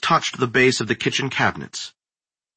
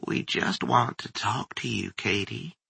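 coaxing creature voice; 8 dB quieter than usual. A narrator's reading. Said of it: "the voice said".

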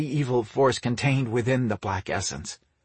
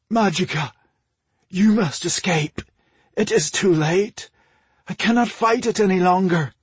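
high, urgent, raised voice; desperate warning; 7 semitones higher, 4 dB louder than usual. A narrator's reading. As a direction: warn her desperately as strength gives out. Losing fight, urges wife to flee.

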